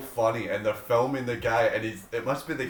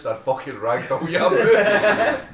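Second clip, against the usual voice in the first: louder, higher, slow, laughing; mock threat; 7 semitones higher, 9 dB louder, much slower than usual. Retelling a guy threatening the organiser, laughing. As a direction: drag out the threat while cracking up. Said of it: "some guy goes".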